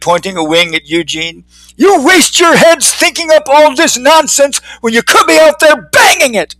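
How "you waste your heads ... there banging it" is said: high, rising into a shout; old-timer scolding. Acted. Old-timer scolds Eugene for wasting time, high and shouting. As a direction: scold him, then blow up at the end. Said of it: "the old-timer said".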